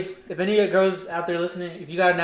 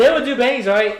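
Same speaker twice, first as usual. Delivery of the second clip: excited; 5 semitones higher, 9 dB louder than usual. Retold or imitated. Excited retelling about bangs.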